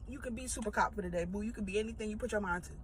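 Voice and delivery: quick, soft mock pep talk; sarcastic encouragement. Vlogger teasing the overeager manager, soft quick sarcasm. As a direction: tease him sweetly but sarcastically.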